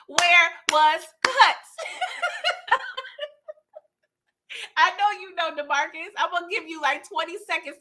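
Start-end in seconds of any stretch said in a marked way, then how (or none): 0.1-1.8 slow, louder, word-by-word question; emphatic questioning. Real, not acted. Host pressing a viewer for an answer, slow and emphatic. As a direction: stretch each word out, amused.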